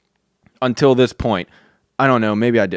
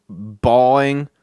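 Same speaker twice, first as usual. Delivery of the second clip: ordinary delivery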